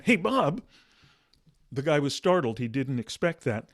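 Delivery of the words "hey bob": sudden call. Retold or imitated. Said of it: "what happened when he went".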